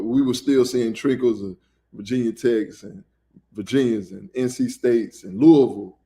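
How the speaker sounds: ordinary talk, nothing marked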